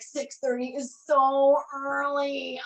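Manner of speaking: slow, stretched-out complaining whine; mock whine. Retold protest to her friend, slow mock whine. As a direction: whine about the early hour like a kid. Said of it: "I was like".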